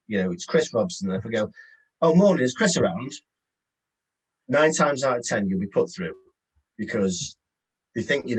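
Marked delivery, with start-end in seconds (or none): 2.0-3.1 breezy, sure of yourself, a bit higher and louder; confident greeting. Shown as a demonstration. Caller to gatekeeper, breezy and confident. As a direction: sound like you already know him. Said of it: "if I go in with a bit of confidence".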